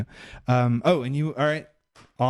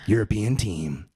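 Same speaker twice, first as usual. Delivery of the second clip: low, slow, solemn roll call; mock announcer; 6 semitones lower, slower than usual. Host reading out the European team in mock announcer voice. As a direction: intone each name deep and deliberate.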